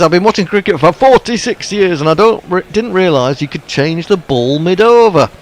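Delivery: put-on reading a viewer's message in a Yorkshire accent. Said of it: "why did I do that in a Yorkshire accent".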